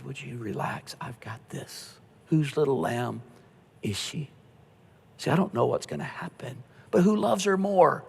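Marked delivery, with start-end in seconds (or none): 0.0-4.3 God's gentle voice. Retold or imitated. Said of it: "But then God said".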